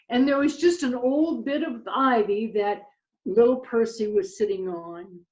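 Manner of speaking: usual speaking voice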